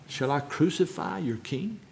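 soft retold question, rising at the end; hushed question. Preacher voices Pilate turning to the mob, quietly. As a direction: put the question quietly and gravely.

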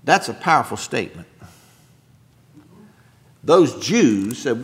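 usual speaking voice

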